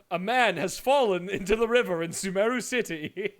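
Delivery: quoting an ad while cracking up; laughing through a quote. Retold or imitated. Streamer reciting a favourite ad line, laughing. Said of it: "That part of that ad all plays in my head".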